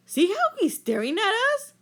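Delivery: high, loud, suspicious fish voice; irritable. A narrator's reading. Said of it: "he went on irritably".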